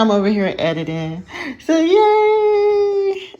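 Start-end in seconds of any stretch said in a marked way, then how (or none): 1.6-3.3 high squeal of joy; excited cheer. Real, not acted. Celebrates finally editing again.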